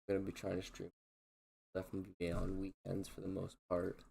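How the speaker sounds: tired, flat monotone. Real, not acted. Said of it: "apologize for the monotone voice".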